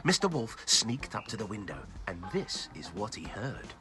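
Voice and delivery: plain reading voice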